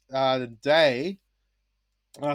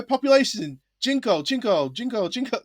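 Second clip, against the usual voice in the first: high, sing-song repeating Jinko; mock teacher voice; 8 semitones higher than usual. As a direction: drill the word Jinko like a lesson. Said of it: "So I'm like, you know".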